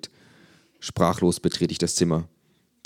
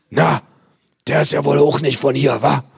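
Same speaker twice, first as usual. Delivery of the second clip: nosy neighbour; 9 semitones higher, 8 dB louder than usual. Acted. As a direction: squawk like a gossiping neighbour. Neighbour voiced in story.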